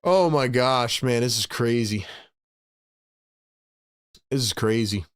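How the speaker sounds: low, subdued amazement; stunned disbelief. Real, not acted. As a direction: let the shock sink in, voice dropped. Stunned after the big play.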